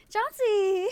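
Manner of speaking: high, loud squeal of a name; excited greeting. Real, not acted. Greeting a chatter by name, delighted squeal.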